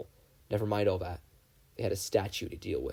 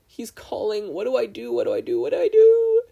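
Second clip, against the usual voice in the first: rising panic, ending in a high crying wail; panicked plea; 11 semitones higher, 10 dB louder than usual. A narrator's reading. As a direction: spiral from worried to frantic sobbing. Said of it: "Mabel made a strangled noise".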